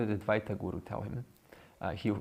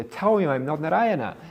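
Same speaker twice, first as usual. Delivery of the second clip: higher and louder, fed up; exasperated shout; 7 semitones higher, 8 dB louder than usual. Acted. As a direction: call the friend in to back him up. Barber appeals to his friend.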